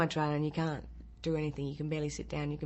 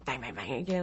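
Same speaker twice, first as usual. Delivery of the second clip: normal talking voice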